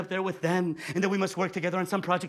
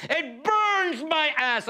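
drawn-out gripe; 7 semitones higher, much slower than usual. Acted. Comic outrage at fourth wall.

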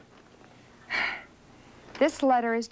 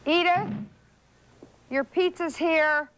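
calling out; 6 semitones higher than usual. Acted. Mother calling child to pizza.

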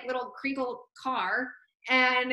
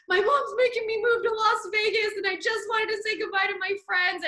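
crying plea; 8 semitones higher than usual. Retold or imitated. Crying excuse to the cop.

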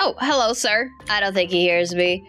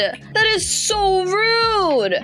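slow, loud, hammed-up wailing complaint; milk the outrage like a fake sob; mock wail; 8 semitones higher, 5 dB louder, much slower than usual. Banned player wails slowly and loudly at the homeowner, mock crying.

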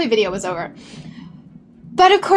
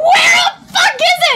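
much higher, louder, fast frantic shout; panicked shout; 4 dB louder, faster than usual. Retold or imitated. Hunting for baking soda during the fire, shouting. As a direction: yell it while tearing through the shelves. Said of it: "I'm like".

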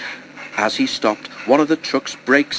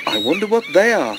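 high, curious little engine voice; wondering aloud at the birds; Toby wondering; 9 semitones higher than usual. A narrator's reading. Toby muses about the seabirds, high and curious. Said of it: "thought Toby".

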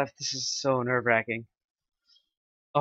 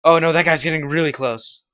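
nervous alarm; 3 semitones higher, 8 dB louder than usual. Real, not acted. Hiding during tense rank quest.

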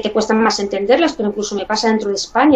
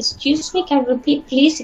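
lightly voiced native asking politely, pitched higher; imitating a native speaker; 5 semitones higher than usual. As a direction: play the native who didn't catch the word, asking higher. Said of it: "y te dice". (imitando a un nativo, con voz algo más aguda)

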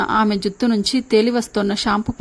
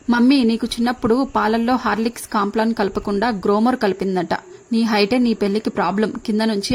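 ordinary delivery

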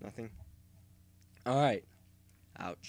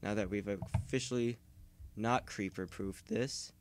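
ordinary delivery

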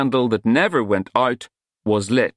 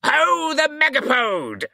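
hunting cry; slower than usual. A narrator's reading. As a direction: bellow it like a wizard on the chase. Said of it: "followed by a cry of".

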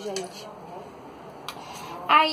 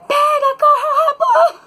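very high, loud re-enacted yell; grito de alarme; 7 dB louder, much slower than usual. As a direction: scream the false alarm over him. (berro agudo, zoando)

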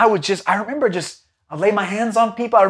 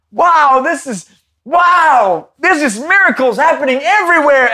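high, loud and drawn-out amazement; retold awe, higher and louder; amazed wow; 8 semitones higher, 6 dB louder, slower than usual. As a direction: marvel at it all. Amazed at miracles.

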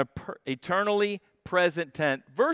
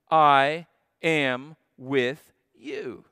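slow solemn quote; 4 dB louder, much slower than usual. Acted. Preacher's climactic slow quote.

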